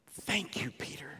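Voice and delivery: breathy whispered thank-you; hushed, secret thanks. Acted. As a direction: whisper it like a grateful aside. Preacher whispers thanks to Peter.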